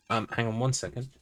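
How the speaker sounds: aside to someone off-mic; muffled, turned from the mic; turning away from phone. Real, not acted. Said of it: "Sorry, that was my wife".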